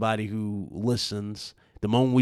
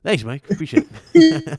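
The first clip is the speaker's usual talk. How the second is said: sarcastic thanks; 10 semitones higher, 9 dB louder than usual. Pretend. Mock thanks, laughing.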